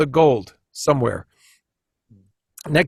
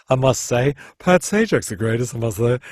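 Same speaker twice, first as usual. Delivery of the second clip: lightly voiced nerdy Ed Grimley; a hint of Ed Grimley's fussy voice; 3 semitones lower than usual. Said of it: "I was doing Ed Grimley".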